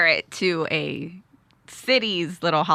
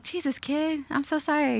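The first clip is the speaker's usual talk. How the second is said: put-on high, soft aside to a kid; lightly acted sheepish sorry; mock apology; 6 semitones higher, 7 dB quieter than usual. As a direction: apologize small and high, under your breath.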